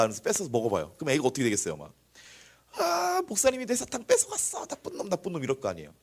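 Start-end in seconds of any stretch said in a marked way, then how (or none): 2.7-5.4 whiny high-pitched tattling, stretched out; crying child. Retold or imitated. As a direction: imitate a little kid bawling and accusing. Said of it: "막 울어요".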